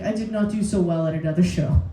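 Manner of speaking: low, deadpan confession; imitating fellow comedian. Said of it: "he was like".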